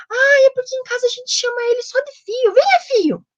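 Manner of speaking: high-pitched imitation of a mother; imitando a mãe. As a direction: play a mother explaining, then calling her son, high. Acts the mother's answer, calling son. (imitação de mãe, voz bem aguda)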